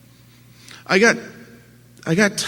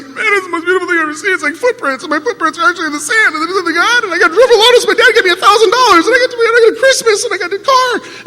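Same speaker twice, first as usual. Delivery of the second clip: much higher, fast, tearful gushing with laughs; imitating Lee blubbering; 10 dB louder, faster than usual. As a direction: gush like an overjoyed friend half crying. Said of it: "and he's blubbering".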